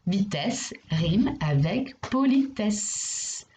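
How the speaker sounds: slow, stressing rime; slow rhyme reveal. Shown as a demonstration. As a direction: announce the answer slowly and clearly. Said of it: "Et oui". (lent, accent sur rime)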